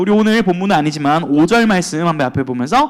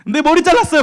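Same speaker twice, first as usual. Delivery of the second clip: chirpy reply; 9 semitones higher, 4 dB louder than usual. Retold or imitated. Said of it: "관심 보였습니다".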